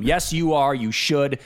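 usual speaking voice

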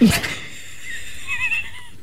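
high squeaky blown raspberry; making fart noises. Real, not acted. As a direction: blow a comic raspberry fart. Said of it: "you're doing fart sound effects".

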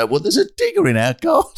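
high, astonished report; mock amazement. Father quoting coming home to a digger, mild mock amazement. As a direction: act out stunned disbelief lightly. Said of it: "You come in one day".

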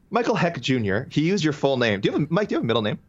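ordinary talk, nothing marked

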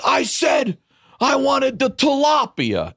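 high mock-furious shout; hammed-up, very high outraged yell; going nuts. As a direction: shout it like a deranged patient demanding dinner. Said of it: "He's going nuts".

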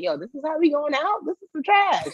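high-pitched, indignant disbelief; playful high-voiced protest; mock disbelief. Acted disbelief at covid shutdown.

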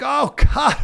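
high, pained "Oh, God"; anguished cry. Shown as a demonstration. Pastor voicing a distressed lamenter, high cry. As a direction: cry out to heaven. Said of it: "it can sound bad, like".

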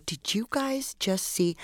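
soft, slow, unsure asking; hesitant quiet question. Retold or imitated. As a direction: ask it timidly, half afraid. Timid question to the family.

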